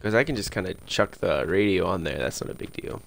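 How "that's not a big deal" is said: whispered aside. Real, not acted. Muttered aside.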